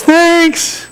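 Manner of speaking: high-pitched mock wifely thanks; put-on gushing thanks. Wife thanking husband for flowers they can't afford, put-on gushing. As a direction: pitch it way up, imitating a grateful wife.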